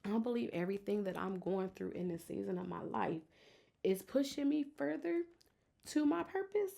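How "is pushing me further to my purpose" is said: near tears. Real, not acted. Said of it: "I'm trying not to cry".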